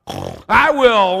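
high, very loud eager shout; eager storybook pig holler; oinking pig. A narrator's reading. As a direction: burst out as an excited cartoon pig. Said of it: "oinked the pig".

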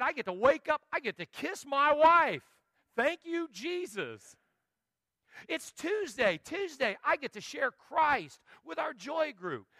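joyful preacher praising every weekday, high and loud; joyful praise. Retold or imitated. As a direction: shout for joy over each new day. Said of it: "I'm like".

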